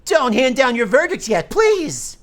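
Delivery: objection shout. Acted. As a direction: burst in to stop the verdict. Lawyer objects in courtroom game.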